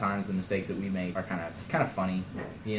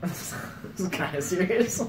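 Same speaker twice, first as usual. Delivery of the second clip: laughing; 9 semitones higher, 3 dB louder than usual. Real, not acted. Cracking up mid-sentence.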